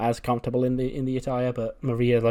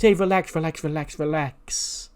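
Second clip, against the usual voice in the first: mock placating; 4 semitones higher than usual. [name] placating angry Johnny, high mock-soothing. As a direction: play at backing off from a threat.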